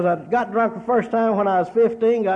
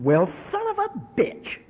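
put-on high, slow little-boy voice, drawn out; a small kid cussing after a spill; little boy cursing; 10 semitones higher, slower than usual. A boy curses after flipping his tricycle, in a high, slow kid voice.

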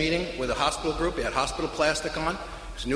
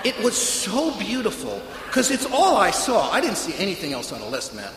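ordinary talk

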